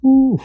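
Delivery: sudden high, loud "Ooh" whoop. Real, not acted. Preacher whooping at sinners testing the Lord, high loud ooh.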